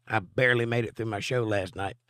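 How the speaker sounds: ordinary delivery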